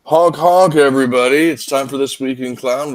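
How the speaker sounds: loud, high clown honk greeting; silly clown. Acted. Host opens clown-themed show.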